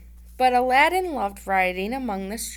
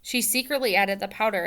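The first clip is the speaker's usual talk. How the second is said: plain reading voice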